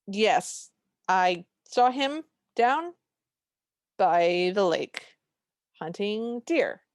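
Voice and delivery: slow, drawn-out words, lightly acted sister; slow mock reply. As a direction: stretch every word out, half-joking. Sisters' drawn-out answer retold.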